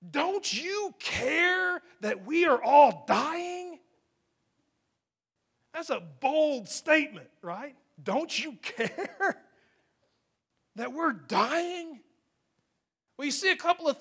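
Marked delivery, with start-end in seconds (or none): 0.1-3.8 slow, drawn-out higher plea; desperate cry. Acted. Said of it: "but they're screaming". 8.0-12.0 desperate plea. Acted. Repeating the disciples' desperate plea.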